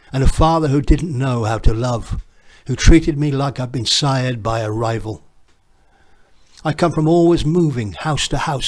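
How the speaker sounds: ordinary delivery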